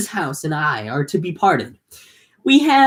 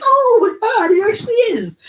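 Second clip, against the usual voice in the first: much higher, loud gushing squeal; squealing Penelope; 4 dB louder than usual. Retold or imitated. Penelope recognizing the robe, squealing much higher. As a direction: squeal it like a starstruck fan. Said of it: "she was like".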